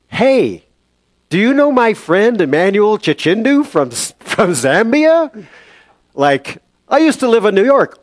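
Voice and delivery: high, loud, eager questioning; hammed-up, high-pitched excitement; mock delirious eagerness. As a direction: call out like a muddled patient making a connection. Acts his delirious question to nurse.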